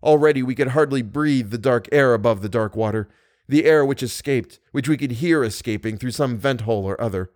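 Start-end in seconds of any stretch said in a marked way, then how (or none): none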